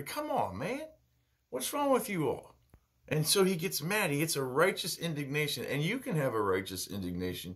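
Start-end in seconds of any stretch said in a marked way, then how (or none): 0.0-2.6 much higher, exasperated, airy rebuke; exasperated Jesus. Retold or imitated. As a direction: throw up your hands at them, half-disbelieving. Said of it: "he gets angry".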